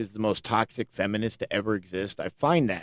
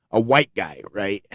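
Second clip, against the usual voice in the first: ordinary talk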